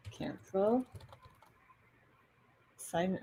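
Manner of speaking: usual speaking voice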